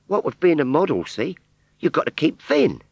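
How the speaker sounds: put-on high Cockney girl's voice; rag trade Cockney. Scarecrow model answering Wurzel in high Cockney. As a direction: pert Cockney shopgirl reply.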